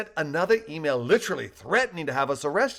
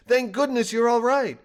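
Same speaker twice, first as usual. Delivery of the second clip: enthused; 10 semitones higher, 6 dB louder than usual. A narrator's reading. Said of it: "balloon enthused".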